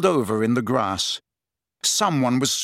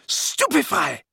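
high, urgent cry of a spell; yelled spell; 11 semitones higher, slower than usual. A narrator's reading. Harry aiming a curse at Snape's back, yelled. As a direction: cast it with a pitched-up yell. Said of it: "took aim at Snape's back and yelled".

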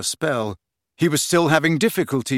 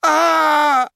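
sudden high yelp of shock; startled yell; 6 dB louder than usual. Acted. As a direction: cry out as you're yanked upside down. Ron hoisted up yelling.

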